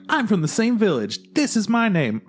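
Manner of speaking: high mock anime rival; shouty rival. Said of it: "he's like".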